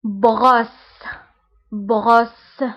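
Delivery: بغوس said as French brosse; teacher modelling بغوس as French brosse; French word model. Shown as a demonstration.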